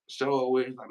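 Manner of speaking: ordinary talk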